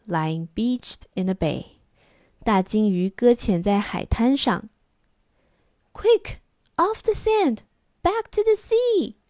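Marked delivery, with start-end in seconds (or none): 5.9-9.2 high urgent little snail cry. Acted. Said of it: "cried the snail".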